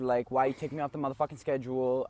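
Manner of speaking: mock voicemail rant. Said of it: "the one where it's like".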